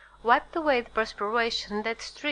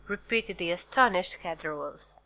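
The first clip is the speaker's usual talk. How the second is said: usual speaking voice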